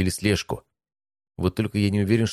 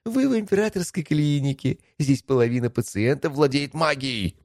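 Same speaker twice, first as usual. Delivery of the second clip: higher, different voice for the patient; character voice; 8 semitones higher than usual. Acted. Character voice in audiobook.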